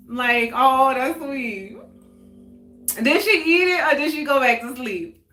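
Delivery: usual speaking voice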